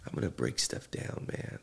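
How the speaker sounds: low breathy philosophical rasp; hushed poet voice. Real, not acted. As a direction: break it down, low and rough. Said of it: "I'm gonna talk like a poet".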